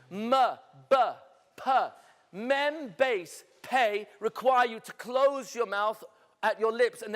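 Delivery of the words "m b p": short isolated sounds for M, B, P; M, B, P as separate demonstrated sounds; letter sounds M, B, P. Shown as a demonstration. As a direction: sound out M, B, P one by one. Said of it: "pronounce the different letters".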